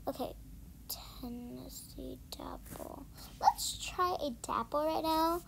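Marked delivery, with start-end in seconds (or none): 0.1-3.1 whisper. Real, not acted.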